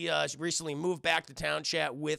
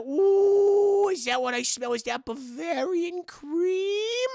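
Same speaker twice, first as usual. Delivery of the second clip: high, loud delighted squeal easing into slow relish; Whitey; 11 semitones higher, 7 dB louder, much slower than usual. Acted. Whitey smelling donuts, in his squeaky excited voice. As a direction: coo high and drag out the last words. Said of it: "And he's like".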